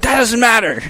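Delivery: high-pitched mock-defensive dad; frustrated comeback. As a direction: brush it off like a flustered dad. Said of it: "he gets frustrated".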